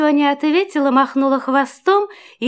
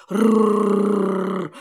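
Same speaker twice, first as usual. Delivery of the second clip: low dog growl; 7 semitones lower, 3 dB quieter than usual. Acted. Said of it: "зарычал пёс".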